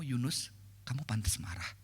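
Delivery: soft, low and restrained; gentle question. Acted. God speaking patiently to angry Yunus, gentle. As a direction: say it quietly, a gentle low rebuke. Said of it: "kita nggak tahu nadanya kayak gimana".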